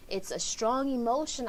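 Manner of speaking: ordinary talk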